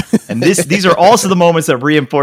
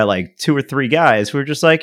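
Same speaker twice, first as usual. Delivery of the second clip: ordinary talk, nothing marked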